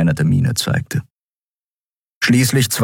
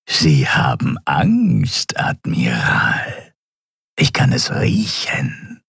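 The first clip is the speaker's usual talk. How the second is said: sinister creature whisper. Acted. Dying Draxyl taunting the admiral slowly in a whisper.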